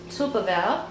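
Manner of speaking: ordinary delivery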